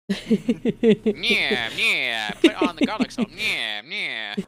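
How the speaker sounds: loud mobster-king caricature; Guy Fieri. Acted. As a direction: punch it out like a cartoon gangster boss. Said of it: "He's the mobster king of Flavor Town".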